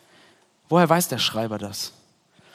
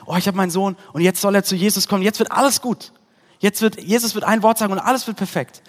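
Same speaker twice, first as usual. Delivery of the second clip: excited; 5 semitones higher, 5 dB louder than usual. Pretend. Father's hopeful imagined thoughts.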